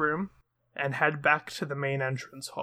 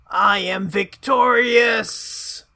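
louder, slow, drawn-out victory shout; triumphant cry; 4 semitones higher, 7 dB louder, much slower than usual. Acted. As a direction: crow it out in triumph. Flame declaring victory.

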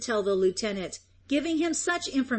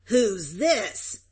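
savage growl. A narrator's reading. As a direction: growl it out as a rough farmer seizing an intruder. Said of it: "growled savagely".

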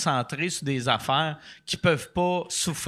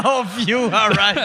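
mock indifferent viewer; 3 semitones higher, 7 dB louder than usual. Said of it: "Fait qu'ils sont comme".

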